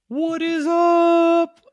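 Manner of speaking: very high, loud, drawn-out squeal; drunk, excited cartoon voice. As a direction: stretch it slowly into a cartoon shriek. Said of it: "when he's drunk or high or excited".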